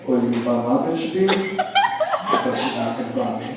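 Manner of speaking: menacing mug-face taunt, low then squeaky; mock tough guy. [name] mugging the admirer, mock menacing taunt. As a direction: glare down the guy and taunt him. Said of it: "Just like".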